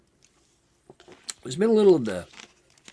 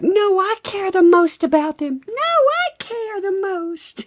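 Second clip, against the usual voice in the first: put-on much higher, squeaky childish insisting; mock squabbling; 4 dB louder than usual. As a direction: bicker like a petulant kid. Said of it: "It's like".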